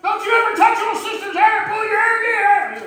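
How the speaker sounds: high, loud yelling at a son; angry dad shouting at his son in the car; retold screaming. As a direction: scream at the boy from the driver's seat. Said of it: "Screaming in the car".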